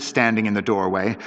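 usual speaking voice

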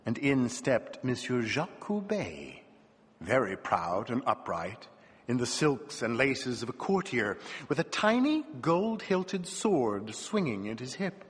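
usual speaking voice

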